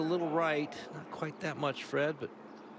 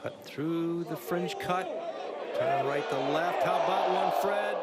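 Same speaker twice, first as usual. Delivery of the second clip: golf commentator; 3 semitones higher than usual. Acted. Live call of putt.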